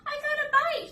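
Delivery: high, loud, thrilled yell; excited shout. Acted. Piggy yelling to Dad as the fish bites, excited shout. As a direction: shout it as the line bends hard. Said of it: "he shouted".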